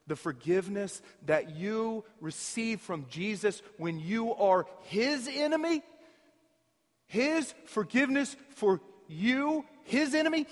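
rising to a loud, high preaching peak; building preaching. Acted. Preacher to congregation, building to a loud peak. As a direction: preach it harder with each phrase.

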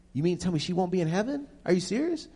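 high, quick incredulous question. Real, not acted. Speaker asking about Mother Teresa and heaven, incredulous. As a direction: pitch leaping in disbelief.

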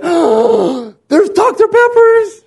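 gasp, then a high, loud mock squeal; hammed-up excited shriek; excited wife. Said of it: "my wife was just so excited".

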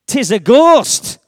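loud, slow cry of alarm; frightened shout. Acted. Fisherman in the boat shouting at a shape on the waves, loud and slow. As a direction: cry out that you've seen a ghost. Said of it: "So he shouted".

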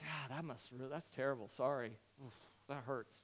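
soft, low, halting condolence; hushed, low sympathetic wince; acted sympathy. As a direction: murmur an awkward sorry to a friend. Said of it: "Sympathy is if".

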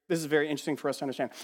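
ordinary talk

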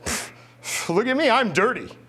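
sneer, then show off; smug scoff. Retold or imitated. Young soldier mocking clean-booted fobbits, smug. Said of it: "It's like".

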